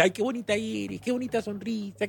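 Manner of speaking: high, sugary mock compliment; imitating a fawning admirer; mock flattery. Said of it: "cuando alguien te dice".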